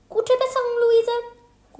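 high, loud call across the room. A narrator's reading. Boy calls get well wishes to Luise, high and loud. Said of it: "ruft Jan Arne".

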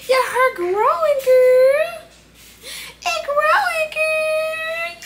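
put-on very high squeaky voice cooing at the baby; high baby talk. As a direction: coo up high like to an infant.

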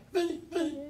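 high, teasing impression of Joe on the phone; imitation of Joe Regano. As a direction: pitch way up to mimic Joe. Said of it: "I used to repeat everything he said".

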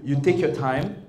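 normal talking voice

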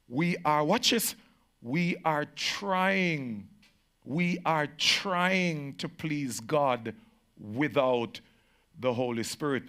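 trying drawn out slow and heavy; stress on trying. Shown as a demonstration. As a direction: lean hard and slow on trying. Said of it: "Notice I emphasize, we are trying".

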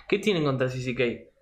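normal talking voice

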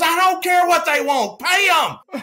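high, loud shout with a laugh; dismissive shout. Retold or imitated. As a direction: bark it out, laughing. Marino dismisses the ransom.

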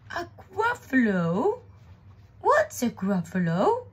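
high, loud incredulous question; astonished owl. Acted. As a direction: stretch it out in baffled surprise. Owl reacts to unknown creature.

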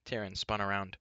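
ordinary delivery, nothing marked

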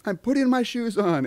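high, loud imitation of an indignant kid; protesting child. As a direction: protest like a kid being nagged. Child protesting to parent, acted.

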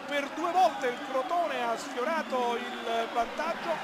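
usual speaking voice

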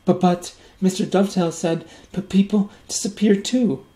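put-on high, loud, stammering little boy; a frightened child stuttering out fears; scared child voice. A narrator's reading.

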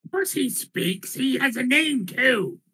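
high, puffed-up silly voice; the Minotaur insists on being named, in a high silly voice; proud Minotaur. Acted. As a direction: boast your mighty name.

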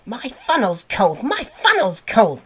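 high, chanting complaint of a little engine; puffing engine. A narrator's reading. Percy complaining to the yard about his cold funnel, puffing. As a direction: puff it out high and rhythmically. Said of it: "he would puff".

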